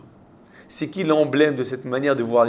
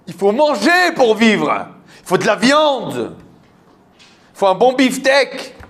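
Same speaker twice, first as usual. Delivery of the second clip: higher, slow, emphatic scoffing; mocking exhortation; 6 semitones higher, 3 dB louder, slower than usual. Og mocking the unleavened bread, scornful exhortation. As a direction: declaim it as a booming champion of appetite.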